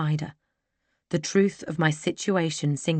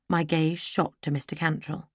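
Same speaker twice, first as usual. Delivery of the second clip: plain reading voice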